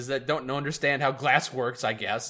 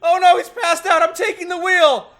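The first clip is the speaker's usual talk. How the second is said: much higher, louder frantic cry; hammed-up alarm; 13 semitones higher, 8 dB louder than usual. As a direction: yell in mock panic as the driver faints. Narrator panics, seizes wheel.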